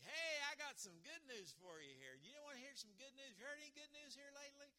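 high, loud eager call; excited announcement. Acted. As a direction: burst out as if bringing great news. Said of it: "It ought to be an excitement".

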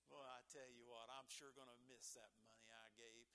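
low, soft, grudging mutter; mock grumbling. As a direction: grumble like a stingy churchgoer over lost money. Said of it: "we're just kinda grumbling about it".

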